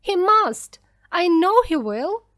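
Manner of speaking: high, loud outcry; frantic insistence. A narrator's reading. Mother insisting God will send someone, frantic. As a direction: cry out with desperate certainty.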